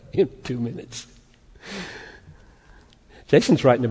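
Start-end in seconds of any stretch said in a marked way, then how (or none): 0.1-1.1 laughing. Real, not acted. Laughing at himself.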